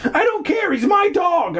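very high hammed-up scream; screamed protest. Boy defending his dog to dad, screaming. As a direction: shriek it in a mock boy's voice. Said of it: "I screamed".

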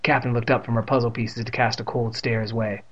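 usual speaking voice

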